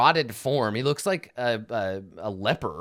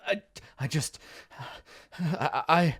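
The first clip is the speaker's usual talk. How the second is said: higher, halting, stammering fear; trembling in fear; 5 semitones higher than usual. Acted. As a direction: stammer as if too scared to finish. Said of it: "he's trembling in fear".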